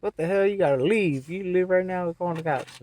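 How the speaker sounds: loud, high retold scolding; angry ejection order. Store staff order the screaming man out, angry. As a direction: raise pitch and volume, imitating irate staff.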